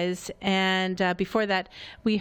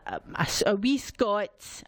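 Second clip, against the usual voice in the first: put-on Scottish accent; much slower than usual. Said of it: "which I can somewhat duplicate".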